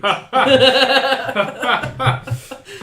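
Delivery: big burst of high loud laughter. Real, not acted. Game master laughing at the player's excuse, loud laugh. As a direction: crack up at the player's line.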